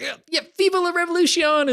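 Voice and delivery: very high, loud mock rallying cry; hammed-up, high-pitched cheer; boisterous shout. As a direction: imitate a fired-up revolutionary yelling a slogan. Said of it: "he's this loud, boisterous guy".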